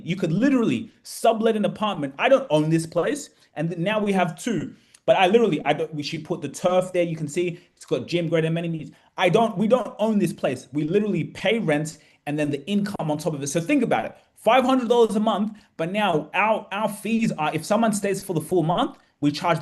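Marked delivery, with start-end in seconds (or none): none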